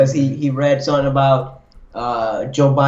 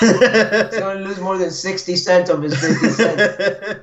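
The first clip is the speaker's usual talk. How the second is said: laughing; 4 semitones higher, slower than usual. Real, not acted. Laughing repeat.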